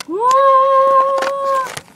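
high, loud startled cry; shocked shout. Acted. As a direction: blurt it out in shock. Sudden outburst, high and loud.

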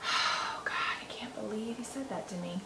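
whispered, as if only thinking it. Shown as a demonstration. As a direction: say it under your breath so no one hears. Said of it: "If you can't say the stuff out loud".

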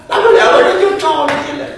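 usual speaking voice

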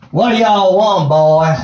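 a poorly done Southern states drawl. Retold or imitated. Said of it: "an admittedly poorly executed southern states drawl".

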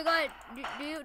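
normal talking voice

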